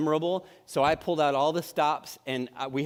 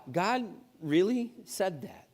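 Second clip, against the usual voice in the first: slow doubting question; 3 semitones higher, slower than usual. Acted.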